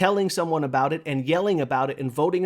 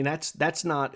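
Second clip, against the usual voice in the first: ordinary talk, nothing marked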